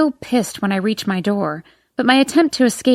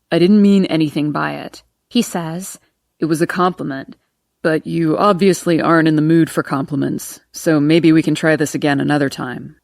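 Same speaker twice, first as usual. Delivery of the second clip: put-on lower, amused young man; lower male voice; 5 semitones lower than usual. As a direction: voice the cop lightly, teasing. Narrator voices Trey.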